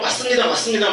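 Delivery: imitating congregants calling out; eager agreement. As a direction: answer like a fervent congregation.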